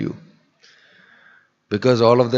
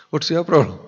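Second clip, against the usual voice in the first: higher, louder, laughing "What's your problem?"; emphatic repeat; 7 semitones higher, 4 dB louder than usual. Shown as a demonstration. Preacher mocking the angry man, louder and laughing.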